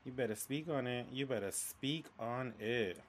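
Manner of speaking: quiet amen-style backing; hushed egging-on. Real, not acted. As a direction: softly cheer him on, stretching the repeat. Co-host softly eggs speaker on.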